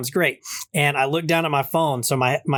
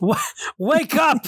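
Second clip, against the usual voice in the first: much higher, slow drawn-out "wake up"; mock Canadian call; 12 semitones higher, slower than usual. Cohost echoing the neighbor's taunt, high slow. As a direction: stretch it out mockingly.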